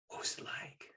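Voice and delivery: hushed, breathless question; whisper. Retold or imitated. Son asking dad in hushed awe. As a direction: ask it in a whisper.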